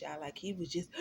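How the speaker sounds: ordinary delivery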